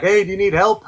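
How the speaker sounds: lightly voiced helpful stranger; a hint of a driver calling from a car; passing driver calling out. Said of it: "was like".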